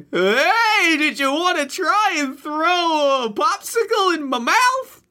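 high-pitched playhouse puppet voice; hammed-up high cartoon object; wacky talking object. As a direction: pipe up high like a zany kids' show prop. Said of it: "to this weird playhouse full of".

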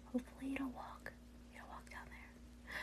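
hushed whisper while hiding. Real, not acted. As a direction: whisper so it won't hear. Hiding from clone.

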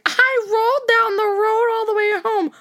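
put-on high, slow, sloppy drunk voice; mock drunk mom rolling home. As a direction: slur it like a tipsy mom. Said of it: "she's like".

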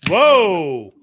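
high, loud whoa; astonished exclamation. Real, not acted. Friend reacting to pictures just sent, astonished exclamation.

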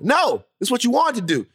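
high, sharp fatherly refusal; indignant dad. Retold or imitated. Dad rejects son's pleading, high and indignant. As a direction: imitate a dad refusing to back down.

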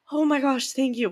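a surprised burst of thanks; grateful surprise. Retold or imitated. Mom retelling thanking neighbor, surprised and grateful.

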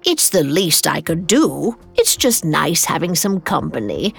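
lightly voiced kindly hostess; scoffing Helga. A narrator's reading. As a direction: brush off thanks in a character voice. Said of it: "Helga scoffed".

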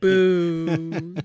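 long, loud drawn-out boo; playful heckle with a laugh behind it; mock booing. Host booing the slip about governor, mock jeer.

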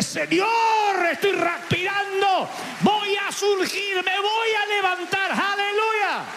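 high, slow shouted declaration; preaching shout. Real, not acted. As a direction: cry it out to the Lord.

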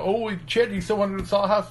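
high, teasing friend's question; mock friend's question. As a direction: ask it sweetly to tease Chad. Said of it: "like, you know".

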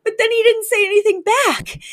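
very high, hurt and theatrical; high wounded Cece. Retold or imitated. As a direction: squeak it out like a spurned socialite. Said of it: "But she's like".

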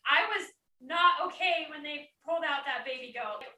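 ordinary talk

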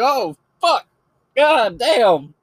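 high, loud mock shock; startled mock outburst. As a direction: react as if yelled awake, hammed-up. Acts out startled viewer being yelled at.

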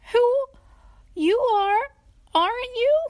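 high, loud squeaky question; tiny character voice. A narrator's reading. Tiny asks her rescuer who he is, squeaky. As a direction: ask it in a little squeak.

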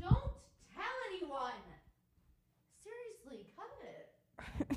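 a quick quiet plea to cut it; hushed aside. Real, not acted. Asks co-host to cut it.

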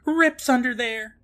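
high, slow mock-alarmed voice; imitated actress. Actress getting up after cabinet falls, imitated high voice. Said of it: "Allison gets up and says".

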